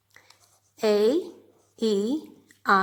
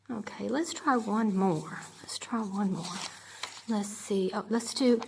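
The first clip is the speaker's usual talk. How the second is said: whispered to herself; 3 semitones lower, 8 dB quieter than usual. Real, not acted. Teacher muttering to herself.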